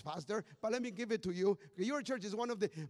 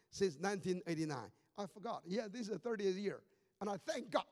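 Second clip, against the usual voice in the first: usual speaking voice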